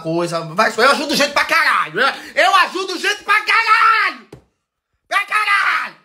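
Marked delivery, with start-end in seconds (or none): 2.0-6.0 high, drawn-out boastful outburst; emphatic shout. Real, not acted. Vlogger insisting how many people they help, shouting emphatically. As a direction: shout it way up high, stretching the words.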